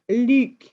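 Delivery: Luke with a French vowel, lips rounded; "Luke" as a French speaker says it. Shown as a demonstration. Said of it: "you must say it this way".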